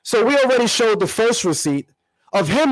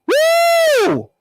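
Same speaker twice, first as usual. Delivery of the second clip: triumphant whoop; 4 dB louder than usual. Real, not acted. Celebrating after the impression.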